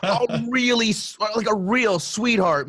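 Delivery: a hint of mock adoration; mock gushing. Real, not acted. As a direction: gush sarcastically. Continuing mock exasperation.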